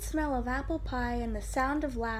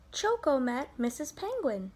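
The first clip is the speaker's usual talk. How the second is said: ordinary delivery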